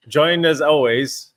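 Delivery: slow, drawn-out imitation of the host; imitating slow intro. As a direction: mimic the host's slow intro. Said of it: "I get".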